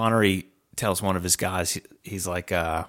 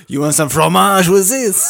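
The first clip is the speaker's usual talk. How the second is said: high, loud hammed-up French waiter, laughing; laughing French waiter act; mock French waiter; 9 semitones higher, 11 dB louder than usual. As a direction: offer the cheese like a campy Paris waiter.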